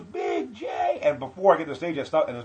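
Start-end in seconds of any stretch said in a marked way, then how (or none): 0.0-1.0 very high, loud mock announcer call; shouted introduction. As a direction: imitate an MC yelling a comic's name. Retold host calling out the name.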